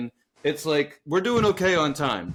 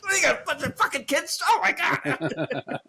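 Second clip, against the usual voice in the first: laughing disbelief; 10 semitones higher than usual. Retold or imitated. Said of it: "I'm like".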